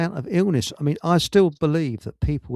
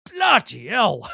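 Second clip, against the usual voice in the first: Cockney exclamation; 5 semitones higher, 3 dB louder, much slower than usual. Retold or imitated. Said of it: "a right old Cockney accent".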